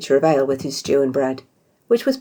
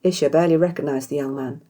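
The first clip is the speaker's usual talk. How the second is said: plain reading voice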